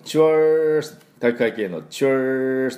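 チュワース with a long, held R; jock-style チュワース greeting. Shown as a demonstration. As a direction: stretch チュワース like a sports-club greeting. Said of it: "体育会系のチュワースの音ですね".

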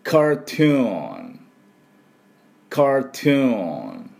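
ゆっくり高めに「カートゥーン」と伸ばす; 「カートゥーン」を英語風に、後半を長く粘らせる; 復唱用の発音手本. Shown as a demonstration. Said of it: "私の後で発音いきましょう". (生徒が後に続くよう「カートゥーン」を音節ごとにゆっくり)

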